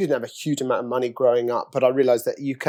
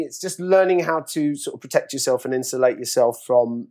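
usual speaking voice

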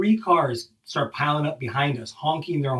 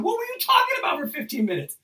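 very high, incredulous retold question; exasperated demand. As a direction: squeal the question in mock exasperation.

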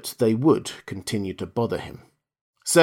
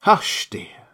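a husband slowly soothing his dying wife; 9 semitones higher than usual. Acted. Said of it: "soothed Abner".

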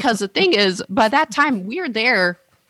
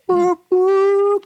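high hooted train whistle; train whistle imitation; 13 semitones higher, 5 dB louder than usual. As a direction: toot it like a steam train.

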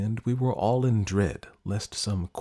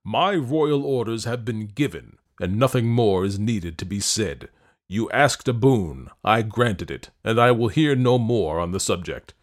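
booming warrior king; 5 dB louder than usual. Acted. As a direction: shut him down with royal force. King angrily silences the prince.

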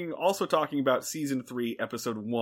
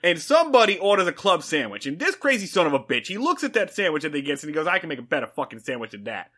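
put-on worked-up Boston accent, higher pitched; 4 semitones higher, 4 dB louder than usual. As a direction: tell it like an excitable Boston barfly. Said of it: "That was a solid Boston".

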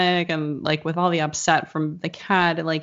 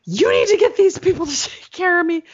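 much higher, laughing mock mother; freaking-out mom; 12 semitones higher, 4 dB louder than usual. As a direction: play the frantic mother, high with a laugh. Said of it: "she's like".

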